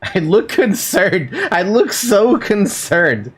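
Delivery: high, slow, amused through laughter; laughing. Real, not acted. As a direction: drag it out while cracking up. Laughing at own character.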